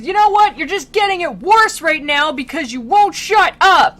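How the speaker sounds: hammed-up rant, high and loud; Virgil losing his temper. Guide losing patience with a damned soul, mock-furious yelling. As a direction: blow up in comic exasperation. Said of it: "losing his temper at this guy".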